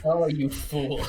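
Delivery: loud, slow, drawn-out mock reproach; hammed-up lament at a clumsy friend; mock scolding. Co-host teasing the wine spiller, mock scolding.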